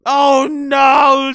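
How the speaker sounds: sudden mock-dismayed shout; loud fake alarm. Real, not acted. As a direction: blurt it out as if the news hurts. Mock dismay at a celebrity photo.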